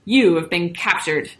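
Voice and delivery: slow, self-important guerrilla; storybook captor's weighty proclamation; proud announcement. A narrator's reading. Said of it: "one gorilla announced proudly".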